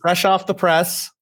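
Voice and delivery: put-on high, slow paper-boy cry; old-time newsboy. Joining the vendor bit, slow high newsboy call. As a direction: call it like a 1920s newsie. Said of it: "That might have been British".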